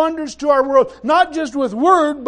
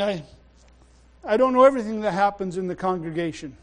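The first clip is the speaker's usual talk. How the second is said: ordinary talk, nothing marked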